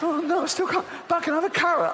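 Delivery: high, panicky stuck shopper; desperate fat shopper. Retold or imitated. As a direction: whine in panic at the narrow door. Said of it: "they go".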